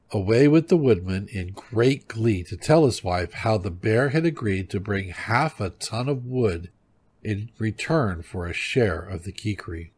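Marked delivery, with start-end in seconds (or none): none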